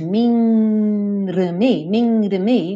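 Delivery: "Minrami" with a tapped r. Shown as a demonstration. Teacher demos the in-between "minrami" sound. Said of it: "o meio do caminho entre minami e minrami".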